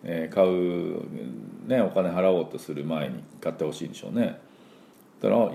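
ordinary talk

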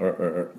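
う as "er", tongue pulled back; short r-coloured う sounds; Rの出だしの音. Shown as a demonstration. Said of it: "この音から始まると". (う を舌を引いた「ァー」のR音で)

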